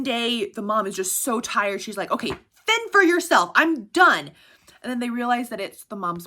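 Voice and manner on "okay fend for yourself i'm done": higher, louder mock exasperation; fed-up mom. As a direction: throw up your hands as a tired mother. Tired mom giving up.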